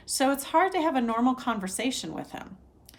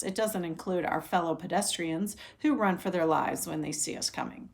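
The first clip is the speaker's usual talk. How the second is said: ordinary delivery, nothing marked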